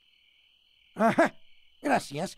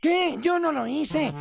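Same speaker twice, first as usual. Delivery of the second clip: indignant surprise; 7 semitones higher, 4 dB louder than usual. Acted. Indignant denial to sleeper.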